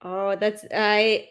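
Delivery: ordinary talk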